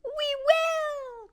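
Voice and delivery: very high, shrill squeak; shrill mouse chorus. A narrator's reading. Mice pledge obedience to their queen, shrill chorus cry. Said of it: "cried all the mice in a shrill chorus".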